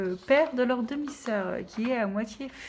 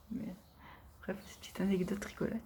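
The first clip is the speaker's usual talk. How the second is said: whispered aside; 10 dB quieter, faster than usual. Real, not acted. Quick aside after book anecdote.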